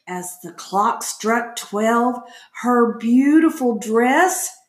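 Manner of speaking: ordinary delivery, nothing marked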